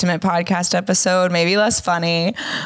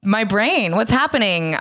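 ordinary talk

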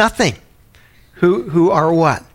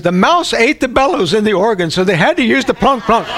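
put-on much higher, matter-of-fact little old lady; old lady imitation; 8 semitones higher, 4 dB louder than usual. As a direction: explain it like a prim grandma, pitched way up. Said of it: "And she goes".

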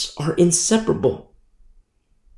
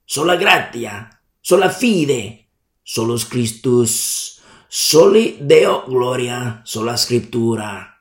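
Italian accent; 5 semitones lower than usual. Shown as a demonstration. Preacher lists the five solas for the congregation in an Italian accent. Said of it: "I say it with my Italian accent on purpose".

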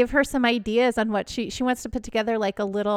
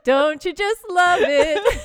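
mock cheery; 6 semitones higher, 5 dB louder, slower than usual. Mocking quote about chicks.

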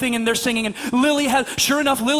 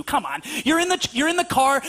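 usual speaking voice

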